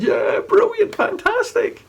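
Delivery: high excited cheer; mock delighted crowd. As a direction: cheer like an impressed audience.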